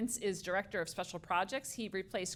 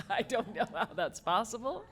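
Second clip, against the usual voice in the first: laughing; 5 semitones higher, 6 dB louder than usual. Real, not acted. Laughing in disbelief at praise.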